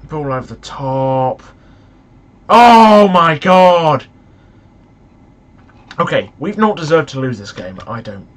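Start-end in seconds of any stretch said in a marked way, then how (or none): 2.5-4.1 loud, slow drawn-out oh my god; real loud disbelief; shocked cry. Real, not acted. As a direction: gasp it out in disbelief. Shocked by a near chance.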